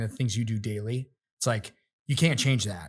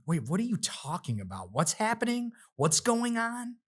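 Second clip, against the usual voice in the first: imitated confusion; 9 semitones higher, 4 dB quieter than usual. Imitating the video editor failing to follow, high-pitched bewilderment. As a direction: act out someone not grasping it, pitch climbing. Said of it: "And he wasn't grasping".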